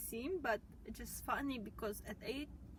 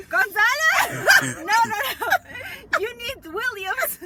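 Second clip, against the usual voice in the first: loud, high mock-outraged dad; a dad dismissing the boy's name; shouting dad; 10 semitones higher, 17 dB louder than usual. Friend playing the dad rejecting Gonzalez, mock shout.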